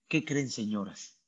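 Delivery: ordinary delivery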